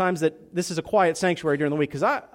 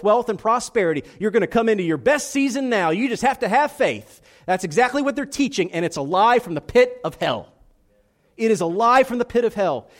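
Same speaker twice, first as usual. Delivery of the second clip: louder, higher, driving sermon delivery; preaching at full force; 5 semitones higher, 6 dB louder than usual. Acted. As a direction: preach it hard against the false gospel.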